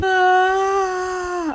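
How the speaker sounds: high release sigh; emotional sound. Shown as a demonstration. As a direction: let it out on ah. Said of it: "These are the sounds of emotion".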